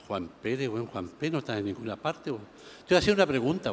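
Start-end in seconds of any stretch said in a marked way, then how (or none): 0.1-2.4 low, soft, puzzled muttering; under-the-breath checking; muttered searching. Acted. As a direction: mumble to yourself over a list that lacks the name. Purga still searches his list.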